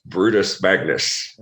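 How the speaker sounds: dramatic slow read of a name; slow, deliberate reading. Retold or imitated. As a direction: spell out Brutus Magnus slowly and heavily. Reading his new name aloud.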